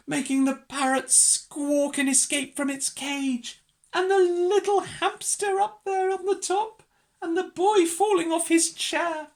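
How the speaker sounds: high, sustained breathless-chase pitch; excited narration. A narrator's reading. Narrator tells Mog's rampage, high-pitched excitement.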